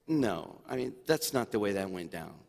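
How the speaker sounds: congregation's imagined doubt, quick and hushed; mock objection. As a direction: brush it off like a doubting listener. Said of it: "And you say".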